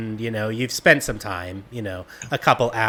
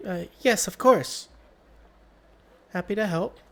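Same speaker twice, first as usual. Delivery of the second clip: hesitant, higher nervous woman; nervous bathhouse keeper; 9 semitones higher than usual. Acted. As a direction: stammer it out nervously. Carmela replies.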